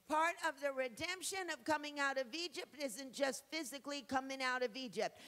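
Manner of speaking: loud, rising preacher's cry, shouting the last line; full-throated revival preaching; preaching at full force. Acted. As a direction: preach it out with everything. Preaching climax on redemption.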